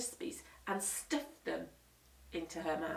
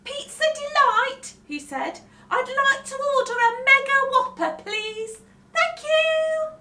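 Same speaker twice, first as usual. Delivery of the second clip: much higher, louder grown-up ordering; Henry on phone; 12 dB louder than usual. Acted. Henry phoning pizza place, cocky high voice. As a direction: order with swagger.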